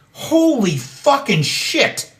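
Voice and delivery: slow, high, stunned curse; stunned outrage. Real, not acted. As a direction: drag it out in shocked disbelief. Reacting to the deportation plan.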